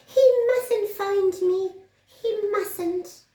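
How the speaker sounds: very high, loud squeaky little mouse. Acted. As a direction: squeak it out like a tiny scared mouse. Mouse voice again.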